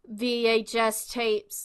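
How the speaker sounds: normal talking voice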